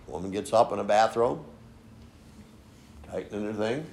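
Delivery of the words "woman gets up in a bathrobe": usual speaking voice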